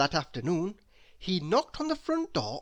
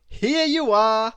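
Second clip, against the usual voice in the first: much louder, slow, proud; presenting; 7 semitones higher, 11 dB louder than usual. A narrator's reading. Mister Happy hands her the box, proud and loud. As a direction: present it grandly.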